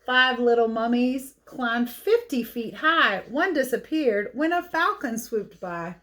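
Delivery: put-on lively read-aloud for kids; picture book reading. A narrator's reading.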